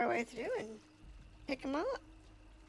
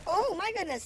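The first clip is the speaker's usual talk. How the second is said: loud high surprised gasp; startled, higher and louder; surprised exclamation; 8 semitones higher, 9 dB louder than usual. Real, not acted. Sees heavy machine gun.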